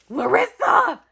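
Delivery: loud, high cry of a name; high, thrilled shout; astonished exclamation. Real, not acted. Calls giver's name in shock.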